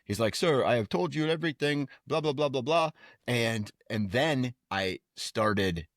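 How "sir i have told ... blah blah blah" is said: mock Indian accent. Scammer voiced in retold call.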